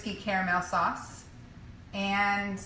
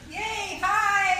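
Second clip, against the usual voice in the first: excited; 10 semitones higher than usual. Real, not acted. Cheerful greeting going live.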